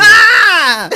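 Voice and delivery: high loud shriek like a siren; imitating a personal alarm with one piercing yell.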